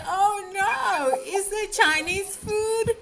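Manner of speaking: high, loud mock horror at no Chinese food; mock dismay. Teacher acting out a student's alarm, mock dismay. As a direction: play up the panic, laughing through it. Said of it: "You say".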